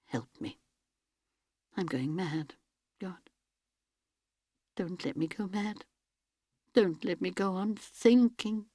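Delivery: soft, desperate plea, barely above a murmur; hushed prayer. Acted. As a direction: beg God quietly, holding back panic. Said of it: "she prayed".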